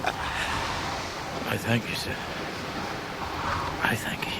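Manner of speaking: slow, breathless whisper; barely able to speak from shock; stunned whisper. Acted. Mr Peggotty thanking Ham after hearing the letter, stunned whisper.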